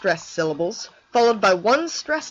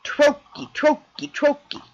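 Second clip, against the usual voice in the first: "Trochee" higher, first syllable TRO punched, "chee" dropped; stress on TRO; 6 semitones higher, slower than usual. Shown as a demonstration. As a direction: say "Trochee" strong-weak, like a beat. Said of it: "You can even hear it in the name".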